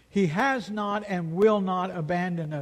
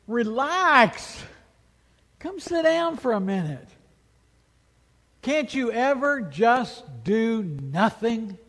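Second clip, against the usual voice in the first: higher and louder, half-pleading, half-scolding; exasperated plea; 4 semitones higher, 4 dB louder than usual. Pretend. Pastor voicing a spouse to an Energizer Bunny, exasperated. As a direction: beg a workaholic to finally sit down. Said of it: "don't you just want to say to them".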